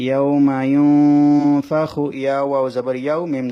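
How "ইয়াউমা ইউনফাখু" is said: ইয়াউমা ইউনফাখু chanted slowly and loud, nun in the nose; slow tajweed chant; ikhfa nasal hold. Shown as a demonstration. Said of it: "সেজন্য নুন সাকিনটা গুণনা করতে হবে".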